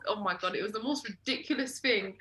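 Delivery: ordinary talk, nothing marked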